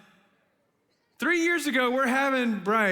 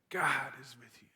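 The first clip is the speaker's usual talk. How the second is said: soft intimate whisper; 7 semitones lower, 8 dB quieter than usual. Real, not acted. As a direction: lean in and breathe it softly. Repeated reassurance, now whispered.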